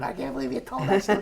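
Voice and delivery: high, rushed mock shriek; retold screaming. Retelling Karen's angry call about the story, mock screaming. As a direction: imitate her outraged, high-pitched scream. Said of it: "she called me up screaming".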